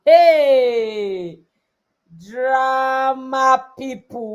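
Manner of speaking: loud slow call to the room. Real, not acted. Teacher calling out to drama-loving girls, loud call. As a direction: summon the drama people. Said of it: "calling out to audience".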